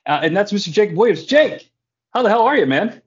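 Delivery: ordinary talk, nothing marked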